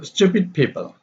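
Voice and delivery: STU-pid PEO-ple, starting high and falling; stressed-unstressed pattern. Shown as a demonstration. Teacher showing the pattern, stressed then unstressed. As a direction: hit the first syllable of "stupid" and "people". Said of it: "stress, unstress".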